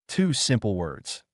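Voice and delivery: ordinary delivery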